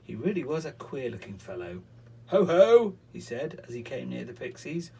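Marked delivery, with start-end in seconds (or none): 2.3-3.0 booming wizard. Acted. Wizard's laugh greeting.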